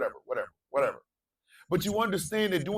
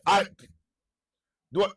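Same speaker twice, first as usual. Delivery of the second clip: higher, louder exasperated demand; exasperated shout; 4 semitones higher, 5 dB louder, much faster than usual. Real, not acted. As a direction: shout it like he's tired of repeating. Exasperated repeat to audience.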